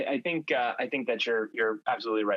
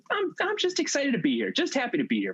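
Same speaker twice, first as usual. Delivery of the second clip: much higher, beaming mock excitement; giddy guy; 14 semitones higher than usual. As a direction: play a man thrilled just to be there. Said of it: "that was just like".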